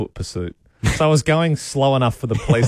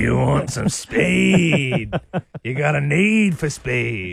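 slow, drawn-out movie-trailer tease; mock dramatic; 4 semitones higher, much slower than usual. Teasing the caller about speeding uphill, drawn out. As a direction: stretch each word for the joke.

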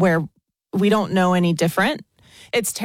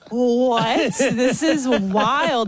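amazed laughing disbelief; 5 semitones higher, much slower than usual. Retold or imitated. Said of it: "And I'm like".

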